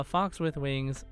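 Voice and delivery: usual speaking voice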